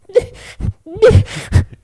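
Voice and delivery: loud, high whimpering squeals; imitated dog yelps. Dog swimming happily among sharks, imitated yelps. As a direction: yelp like an excited paddling dog.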